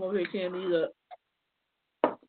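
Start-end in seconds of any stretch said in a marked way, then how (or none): none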